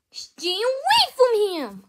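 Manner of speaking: long, high, loud warning; protective shout. Acted. Defender warning an attacker off a friend, drawn-out shout. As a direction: yell it slowly, swooping up high.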